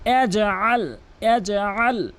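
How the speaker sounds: ইয়াজআল with bounced j and throaty ain; careful recited ইয়াজআল; correct ain. Shown as a demonstration. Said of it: "আল বলতে হবে".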